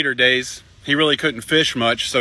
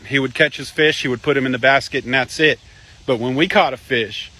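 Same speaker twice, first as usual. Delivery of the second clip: ordinary talk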